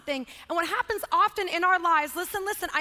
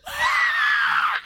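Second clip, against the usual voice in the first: gasp, then loud high shriek; huge intake into a piercing wail; imitated child's scream; 8 dB louder than usual. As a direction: scream like a wailing little boy. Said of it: "and just starts screaming".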